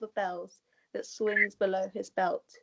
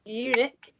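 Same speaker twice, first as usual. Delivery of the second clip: ordinary talk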